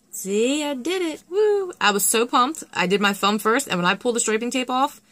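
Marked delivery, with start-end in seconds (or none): 0.1-1.7 high, thrilled cheer; real burst of joy at the result; excited whoop. Real, not acted. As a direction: squeal it out in delight. Thrilled the stripes worked.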